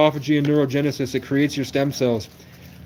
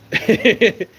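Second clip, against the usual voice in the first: high, loud quick laugh at himself; real amused cackle, then back to talk; sudden self-amused laugh; short burst of laughter; 9 semitones higher, 5 dB louder than usual. Real, not acted.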